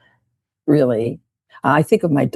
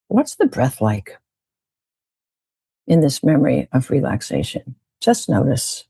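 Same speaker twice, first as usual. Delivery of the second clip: guided meditation. Acted. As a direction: lead the group gently into a relaxed memory. Leads a guided breathing meditation.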